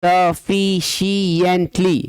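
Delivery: sufficiently slowly as suh-fi-shi-ent-lee; every beat of sufficiently separately stressed; syllable-by-syllable reading. Shown as a demonstration. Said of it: "more than three".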